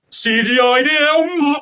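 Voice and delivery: sarcastic slow yell at the fake deer; mocking outburst. Real, not acted. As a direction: stretch it out slowly in ridicule.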